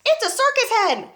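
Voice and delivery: high, loud astonished call; amazed shout. Acted. Grandma shouts in amazement from the rooftop. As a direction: marvel at the hen. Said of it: "Grandma shouted from the rooftop".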